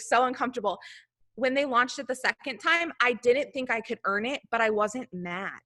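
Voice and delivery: ordinary talk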